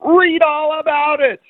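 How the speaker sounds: high, drawn-out newsboy street cry; newsboy yell. Acted. Newsboys in Boise out with their newspapers, yelling. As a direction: call it out like a newsboy hawking papers. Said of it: "out there with their newspapers yelling".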